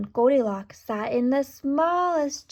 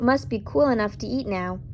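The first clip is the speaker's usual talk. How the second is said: ordinary delivery, nothing marked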